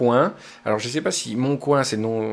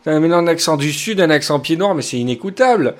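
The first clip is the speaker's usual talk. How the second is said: exasperated editor; 5 semitones higher, 5 dB louder than usual. Retold or imitated. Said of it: "Il me fait".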